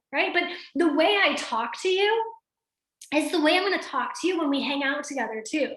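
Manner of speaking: ordinary delivery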